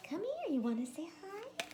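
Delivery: high hushed coaxing pet voice. Real, not acted. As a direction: lure the cat in with a hushed coo. Coaxing cat to say hi.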